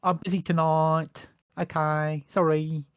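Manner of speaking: mock Essex lad brushing them off; Essex accent. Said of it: "in a strong Essex accent as well".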